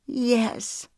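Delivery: high, elated yes; joyful exclamation. A narrator's reading. Woman accepting a proposal, exclaiming with joy. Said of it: "Bertie exclaimed".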